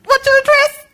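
high, urgent pleading that turns breathless; the desperate half of her mind, pushing past the fear; desperate inner voice. Retold or imitated. Her desperate inner voice deciding to go anyway, high and urgent. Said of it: "Other part went".

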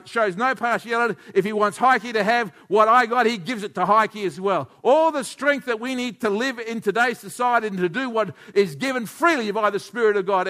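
high, loud and rousing; full-force preaching. Acted. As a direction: lift the room with a rising sermon.

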